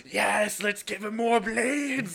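loud, high, drawn-out mad doctor cry; hammed-up ghoulish doctor, shouting. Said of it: "I keep imagining the really ghoulish, ghoulish doctor".

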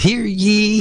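high ringing crier's call; town crier. Acted. Host announces the listening game.

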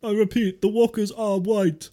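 mock radio relay.

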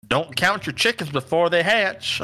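put-on Southern accent. Quoting an old saying to friends in a light Southern accent. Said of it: "I don't know why I put that accent on there".

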